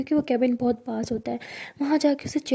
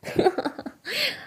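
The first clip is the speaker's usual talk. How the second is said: squealing laugh much higher; 11 semitones higher, 3 dB quieter than usual. A narrator's reading. Saniya laughing at Zoya's jealousy. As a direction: burst into a high laugh. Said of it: "Sania हंसते हुए कहती".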